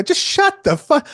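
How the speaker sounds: high, loud, slow mock yell; mock outrage. Photographer cutting off the admiring musician, in mock outrage. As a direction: play up indignant disbelief. Repeats acted outburst.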